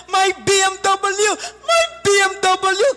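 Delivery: high, loud panicked cry; mock shout. Crash victim shouting about his BMW, loud high cry. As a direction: yell it out in panic. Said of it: "Sumigaw yung tao".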